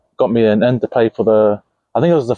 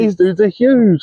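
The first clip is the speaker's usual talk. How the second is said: mock scared; 8 semitones higher, slower than usual. Retelling scared thought.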